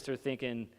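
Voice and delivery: normal talking voice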